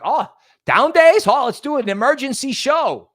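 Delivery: high, loud mock excited voice; hammed-up eager Toby; imitating Toby. Mocking his cohost's eagerness for an emergency show. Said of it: "Toby's like".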